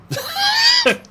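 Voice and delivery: very high squealing laugh; high-pitched embarrassed giggle; squeaky laugh. Real, not acted. Laughs after joke.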